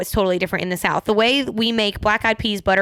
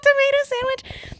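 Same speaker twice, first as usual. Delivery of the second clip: very high, incredulous put-on voice; shocked friend. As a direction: mimic a New Yorker baffled by the offer. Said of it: "He was like".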